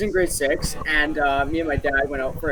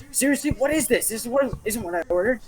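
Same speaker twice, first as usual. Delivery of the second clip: indignant complaint; 7 semitones higher than usual. Retold or imitated. Drunk lady complaining loudly.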